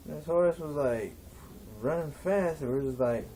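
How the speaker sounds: ordinary delivery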